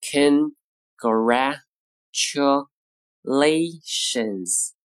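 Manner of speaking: very slow, each syllable separated by a pause. Shown as a demonstration. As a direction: break the word into five separate syllables. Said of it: "咱们逐个音节呢，再来读一下".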